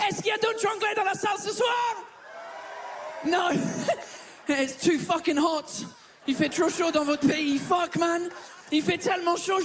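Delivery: lightly put-on English accent in French; fake English accent. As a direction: play the Brit who mangles French. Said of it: "cet accent de merde". (faux accent anglais léger)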